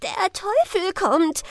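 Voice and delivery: high, slow, shrieking cry; shrieking villagers. A narrator's reading. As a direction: shriek it out in terror.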